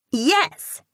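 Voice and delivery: put-on high, loud happy child's yes; gleeful. A narrator's reading. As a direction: answer with pure glee. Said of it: "she answers gleefully".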